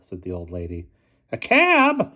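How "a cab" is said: high, loud grumpy squeak. A narrator's reading. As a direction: say it sulkily, put out. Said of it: "said the station master grumpily".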